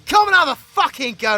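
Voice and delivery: high, loud taunting shout; aggressive challenge. Acted. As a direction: goad him into a fistfight. Challenging rival to a brawl.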